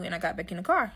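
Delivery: normal talking voice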